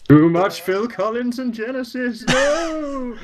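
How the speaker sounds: high, drawn-out comic wail; mock horror. Stuck in the eighties again, mock-horrified wail. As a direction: protest in hammed-up dismay, climbing high.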